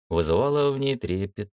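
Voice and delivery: ordinary delivery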